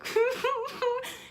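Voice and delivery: high-pitched mock sobs while singing; hammed-up wailing, very high; imitated sobbing. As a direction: squeak out broken crying sounds. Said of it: "when I would sing crying, it was like".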